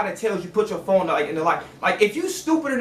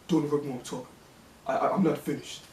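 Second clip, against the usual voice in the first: low, soft, stern warning; Shaq treatment; 8 semitones lower, 10 dB quieter, faster than usual. Retold or imitated. Worker cutting off an interrupting supervisor, low stern Shaq treatment. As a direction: drop the voice and set a firm boundary. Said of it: "I gave her ass a Shaq treatment".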